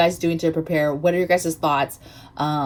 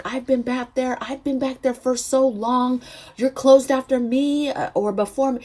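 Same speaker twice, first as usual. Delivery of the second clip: higher-pitched, imitated complaint; put-on upset whine, pitched up; upset customer; 7 semitones higher than usual. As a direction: mimic an indignant customer protesting her wait. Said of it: "Just upset".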